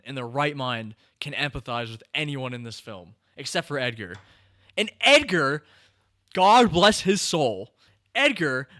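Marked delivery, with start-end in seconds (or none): 4.8-8.7 gushing sympathy. Real, not acted. Gushing over the good husband.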